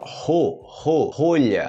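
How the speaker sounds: slow "ro", then "rolha", R as H; syllable demonstration. Shown as a demonstration. Teacher shows learners how rolha starts, slow pronunciation demonstration. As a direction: draw out "ro" before completing "rolha". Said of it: "olha só".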